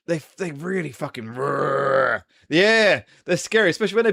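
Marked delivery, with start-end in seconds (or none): 1.3-2.2 drawn-out imitation of beetle wings; imitated beetle roar. Describing huge beetles taking off, imitated roar. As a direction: roar out the noise of take-off.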